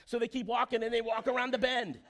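preacher getting carried away describing a huge house; excited storytelling. A narrator's reading. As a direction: sell the mansion like it's the best news ever.

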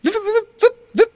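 tiny squeaky chirps, very high; chirping light creature. Acted. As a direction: chirp like a little flitting light. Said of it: "starts to flit around you and goes".

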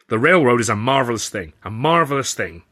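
loud, pushing booming voice; barreling on. A narrator's reading. Considine barreling on to his guests, loud and booming. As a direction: steamroll right over them. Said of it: "Considine barreled on".

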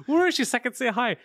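high eager mock excitement; surprised high call; excited. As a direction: pitch it up, eager to say hi.

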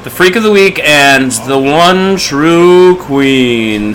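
slow, loud segment announcement; announcer voice. Acted. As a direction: crown it like a game-show host.

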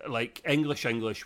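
ordinary delivery

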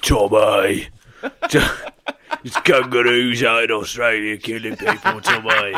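loud Peaky Blinders parody; bellowed Birmingham gangster accent; mock Brummie shout. Alerting Tommy to killer kangaroos, loud mock Brummie. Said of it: "kangaroo Shelby".

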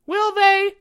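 very high, loud questioning squeak; doubtful. A narrator's reading. Charlie questions what the teachers will say, doubtfully. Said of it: "said Charlie doubtfully".

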